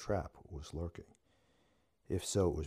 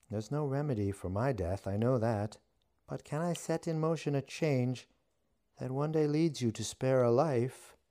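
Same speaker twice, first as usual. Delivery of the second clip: high, loud pleading witch; 8 semitones higher, 6 dB louder than usual. A narrator's reading. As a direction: pitch it up, hopeful and loud. Witch voice held.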